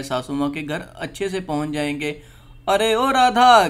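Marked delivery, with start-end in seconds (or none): 2.7-3.7 loud, drawn-out call to his wife; hey Radha, stretched and loud. Acted. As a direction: call her from across the house, slow and loud. Husband calling wife from afar.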